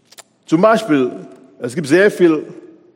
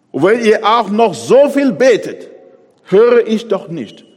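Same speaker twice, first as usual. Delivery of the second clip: louder, higher, pressing: God won't listen; God's stern refusal; 7 semitones higher, 6 dB louder than usual. Acted. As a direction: thunder it as God turning away. Preacher voices God's refusal. (laut und höher als Gottes strenge Ablehnung)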